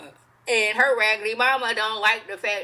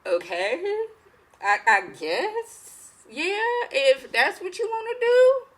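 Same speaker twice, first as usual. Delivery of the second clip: awkward hesitant agreement; 9 semitones higher, slower than usual. Retold or imitated. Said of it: "They looking like".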